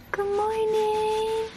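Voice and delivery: high, slow, soft cooing good morning. Real, not acted. Mom wakes baby up.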